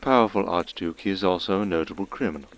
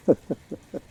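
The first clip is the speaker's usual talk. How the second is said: a high, amused little laugh; teasing laugh; sly chuckle; 8 semitones higher than usual. Acted. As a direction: laugh at her clumsiness. Caller chuckles at her grip.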